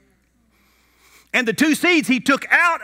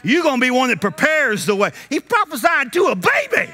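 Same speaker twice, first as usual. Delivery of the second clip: impassioned preaching; 4 semitones higher than usual. Real, not acted. Preacher marvelling at prophecy over a baby, impassioned preaching. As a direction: rise to a high preacher's shout.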